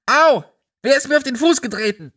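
pained yelp. Acted. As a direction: cry out in pain, then snap quickly. Someone stepped on in chaos.

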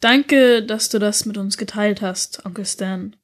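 plain reading voice

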